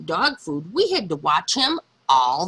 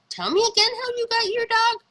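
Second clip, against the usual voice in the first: high, fast, cheeky; teasing; faster than usual. Acted. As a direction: tease your friend playfully. Girl teasing friend Martha.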